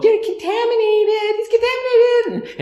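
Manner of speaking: high singsong schoolyard chant; kids' taunt. Retold or imitated. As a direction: tease like a kid at recess. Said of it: "we would go".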